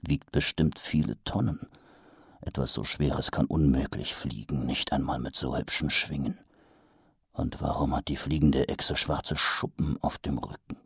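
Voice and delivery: quiet, reasoning under the breath; doubting inner voice. A narrator's reading. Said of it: "gaben sie zu bedenken".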